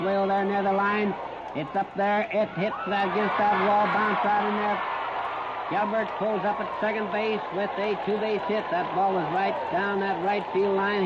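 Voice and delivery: usual speaking voice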